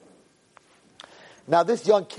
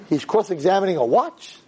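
usual speaking voice

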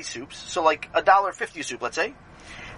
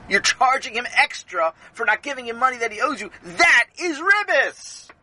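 emphatic verdict; 6 semitones higher than usual. Acted. Rebbe delivers his verdict.

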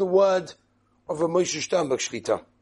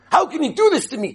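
fast, higher indignant outburst; furious; 7 semitones higher, 5 dB louder, faster than usual. Retold or imitated. Husband fuming that the tailor cheated him, fast indignant outburst. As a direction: sputter with outrage at being cheated. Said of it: "The guy was furious".

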